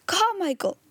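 high call, falling at the end; crying out. A narrator's reading. Indian gentleman calling Carmichael, crying out. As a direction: cry the name out urgently. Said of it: "cried out loudly".

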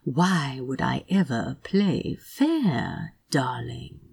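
slow, breathy teasing whisper; seductive whisper. A narrator's reading. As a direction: whisper it into his ear.

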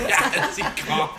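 laughing. Real, not acted. Laughing after thigh rub.

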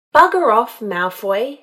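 angry dismissal. A narrator's reading. Drunk woman in the creek to her enemy, angry dismissal. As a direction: send him packing with a loud outburst.

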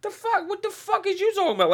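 high incredulous squeal. Real, not acted. Reacting to the bully story, high disbelief. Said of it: "Like, what?".

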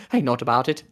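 a hint of an Irish lilt on "Hey, note about it"; playful half-Irish try at the word "nowt"; a little Irish. Shown as a demonstration. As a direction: say "note" with a light Irish accent, rising on "Hey". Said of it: "Little leprechaun coming out".

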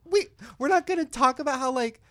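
high-pitched, loud disbelief; incredulous reaction. Retold or imitated. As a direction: protest in baffled amazement. Acts own disbelieving reaction to host.

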